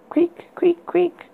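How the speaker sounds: high breathy creak whispered. A narrator's reading. Creaking sound effect voiced.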